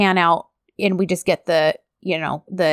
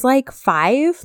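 ordinary talk, nothing marked

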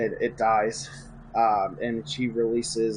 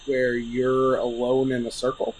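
ordinary delivery

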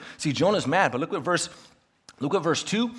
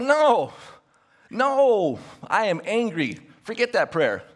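Jonah; 6 semitones higher, slower than usual. Acted. Jonah takes back his prayer to God, acted anger. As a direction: refuse in a hammed-up temper, easing off. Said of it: "he's exceedingly angry".

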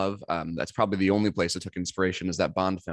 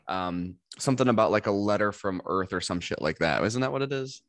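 usual speaking voice

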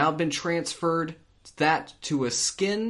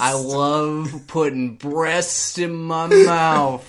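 creepy voice; 2 semitones higher, 6 dB louder, much slower than usual. Pretend. Creepy KFC customer bit.

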